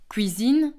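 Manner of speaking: "cuisine" said as "kwizine"; "cuisine" with w after the k; Belgian pronunciation. Shown as a demonstration. Teacher showing learners the Belgian cuisine, pronounced "kwizine". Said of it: "en Belgique".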